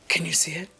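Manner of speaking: unvoiced hushed repeat of the question; whisper. Shown as a demonstration. Vlogger repeats her question to viewers, whispering. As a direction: breathe the question with no voice. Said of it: "I'm like".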